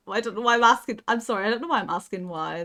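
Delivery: ordinary talk, nothing marked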